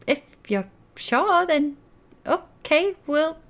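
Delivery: high-pitched, halting auntie voice; hesitant agreement, pitched well up; sceptical auntie. Acted. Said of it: "raises an eyebrow and she's like".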